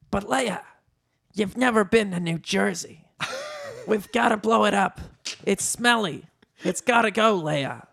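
high, insistent pleading; Danny DeVito. A narrator's reading. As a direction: argue the case as a little man.

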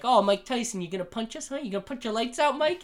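high, sing-song teasing; taunting. Retold or imitated. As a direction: goad him like a bratty sibling. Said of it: "when you'd start calling him it".

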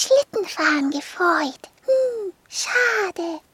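put-on be a little girl sulking about no snow; little girl whining about the missing snow; disappointed little girl. Little girl Marie complains.

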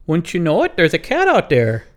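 loud, high, hammed-up Wisconsin accent. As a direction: exclaim it as a folksy Wisconsin local. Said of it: "more of a Wisconsin accent".

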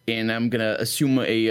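ordinary talk

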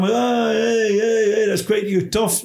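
high mock cheering of a thrilled audience; lightly hammed-up crowd excitement. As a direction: cheer like a delighted small crowd. Imitates the cheering pub crowd.

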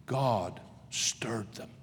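awed pulpit whisper. Acted. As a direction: drop to a hushed whisper. Hushed aside after climax.

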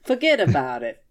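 lightly put-on New York wiseguy; a hint of a New York wiseguy, laughing; New York accent. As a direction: toss it off like a New York mobster. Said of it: "New Jersey and New York".

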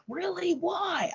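put-on very high, questioning kid's voice; surprised student. Student to teacher after hearing she'd be upset, surprised. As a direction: imitate a child genuinely taken aback.

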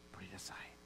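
whispered, low and gentle; hushed command. Acted. Pastor voicing Paul to the congregation: a soft whisper. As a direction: lean in and breathe it.

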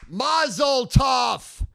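slow, high, loud cheer; shouted mazel tov. Real, not acted. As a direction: bellow it out slowly. Mock congratulations shouted.

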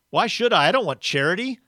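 loud, high-pitched, argumentative outburst; belligerent, aggrieved character voice; indignant ghost. Acted. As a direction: argue back, insisting on your rights. Ghost refuses charity.

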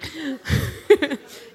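long real laugh. Real, not acted. Preacher laughing at herself in front of the congregation. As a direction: keep giggling.

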